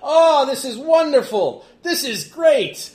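loud, slow, high-pitched glee; laughing delight. Acted. Froman's nephew revels in the standoff, laughing delight. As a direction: crow with uproarious delight.

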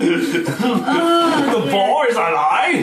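slow excited repeat. Retold or imitated. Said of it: "I just said that like".

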